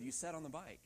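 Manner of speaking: usual speaking voice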